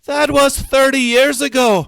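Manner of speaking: very high, loud comeback; high-pitched quarrelling shout; angry yell. A narrator's reading. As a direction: shout it back, exasperated. Graham yells back in quarrel.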